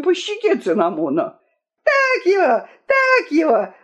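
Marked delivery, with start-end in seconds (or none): 1.8-3.7 high, squawking parrot cheer; delighted parrot. A narrator's reading. Parrot egging on the fighting kings, delighted squawk. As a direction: screech it in gleeful parrot voice. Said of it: "закричал обрадованный попугай".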